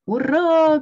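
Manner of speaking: loud, high whoop; cheering shout. A narrator's reading. Frog cheering as the sled speeds downhill, loud whoop. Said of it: "крикнул Квак".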